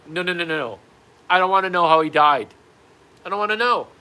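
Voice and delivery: loud, high-pitched protest; acted insistent objection; emphatic refusal. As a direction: cut them off loudly. Acted refusal to hear the death.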